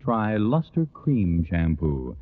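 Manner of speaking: ordinary delivery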